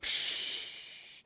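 hissed rush of water; water whoosh. Shown as a demonstration. Teacher illustrating water running through a tube, imitated whoosh. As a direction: imitate water spraying from a hose.

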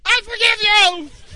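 slow, very high shout; yelling to be heard. Retold or imitated. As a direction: holler it for someone hard of hearing. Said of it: "I literally had to say".

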